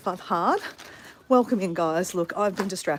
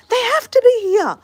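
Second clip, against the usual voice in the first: high, loud, insistent holler; re-enacted yelling; 10 dB louder than usual. Insisting from upstairs that eggs are there, acted holler. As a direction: shout it back, acting out disbelief. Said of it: "So this is yelling out from upstairs, downstairs".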